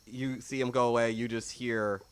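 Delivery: usual speaking voice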